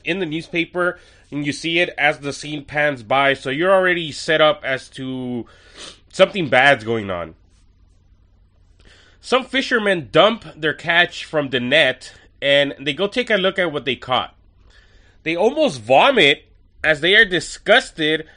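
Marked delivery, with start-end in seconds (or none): none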